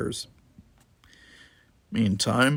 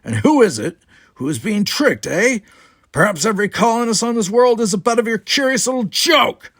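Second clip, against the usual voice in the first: rising to a much higher, loud rage; furious, much higher accusation; louder, angrier; 12 semitones higher, 9 dB louder than usual. Acted. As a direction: build the fury line by line. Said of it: "Zach's voice became louder, angrier".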